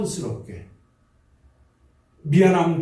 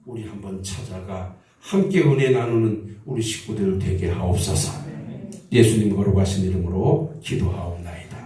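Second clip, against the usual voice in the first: much lower, settled prayer voice; closing prayer; 8 semitones lower than usual. Real, not acted. Pastor ending prayer in Jesus' name, low and settled. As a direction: bring the prayer home low and steady.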